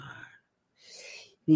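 slow hushed whisper; whispered slow narration. A narrator's reading. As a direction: breathe it slowly, low. Hushed retelling of movie scene.

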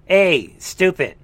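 ordinary delivery

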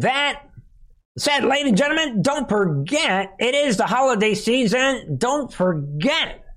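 high, showy pitchman call; announcer voice. Pretend. Host opens a holiday sponsor plug, grand announcer style. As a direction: sing out the plug like a show announcer.

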